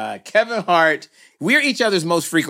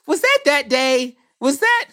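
high, feigned-innocent Kevin voice; pretending he forgot the date; mock innocent Kevin; 10 semitones higher than usual. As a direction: play dumb, voice jumping way up.

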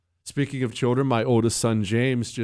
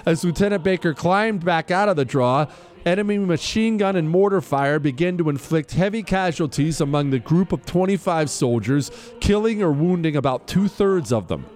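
formal citation read over music; crisp military citation; 4 semitones higher than usual. Acted. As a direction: read it like an official military commendation. Continues reading the citation.

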